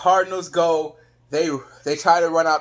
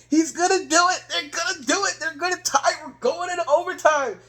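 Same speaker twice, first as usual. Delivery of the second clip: high-pitched, thrilled hope; excited cheering fan; 8 semitones higher than usual. Retold or imitated. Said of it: "That was me. I'm thinking".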